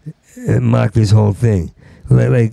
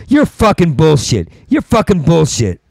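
higher mock screenwriters bickering; screenwriter squabble; 6 semitones higher than usual. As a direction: ham up two writers snapping at each other. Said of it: "if they sound like this and they're talking to each other like that".

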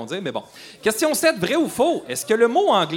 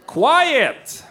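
loud ironic call; 11 semitones higher, 8 dB louder than usual. Shown as a demonstration. Host says word quiet loudly.

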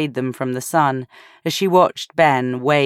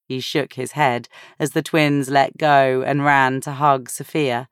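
ordinary delivery, nothing marked

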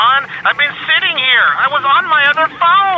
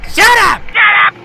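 angry shout; 7 semitones higher, 4 dB louder than usual. Acted. Ollie yelling shut up.

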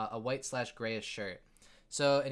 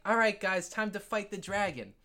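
lightly voiced excited kid, pitched up; rally your playmates in a kid's higher voice; kid game master; 6 semitones higher than usual. Kid calling friends to fight the dragon, lightly acted rallying. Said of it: "he's just like".

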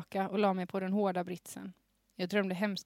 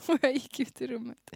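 normal talking voice

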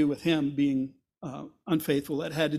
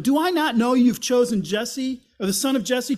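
loud, higher, raging accusation; furious Saul; 5 semitones higher, 7 dB louder than usual. Acted. Saul accusing Jonathan of siding with David, furious shout. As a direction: hurl the accusation at him.

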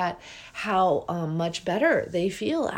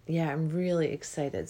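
ordinary talk, nothing marked

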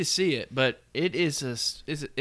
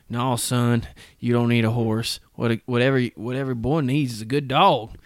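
a put-on country-dad accent, a bit louder; dad's accent; 4 dB louder than usual. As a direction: play the dad lecturing his son in an old-time rural accent. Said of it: "I love how you went into an accent for it".

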